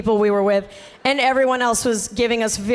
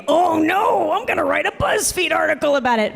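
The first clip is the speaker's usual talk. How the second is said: higher, put-on fretful voice; mock alarm; 4 semitones higher than usual. Host mimics a critic threatening an article, high mock alarm. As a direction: play an outraged onlooker in exaggerated dismay.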